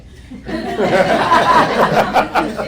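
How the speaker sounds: loud burst of embarrassed laughter. Real, not acted. Laughing at her own horoscope story, loud real laugh.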